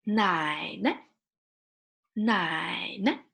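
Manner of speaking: "Nai ne" with a long dipping "Nai", short "ne"; "Nai ne" with a toneless, clipped last syllable; neutral tone ending. Shown as a demonstration. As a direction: draw out "Nai", then drop a quick "ne". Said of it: "So short".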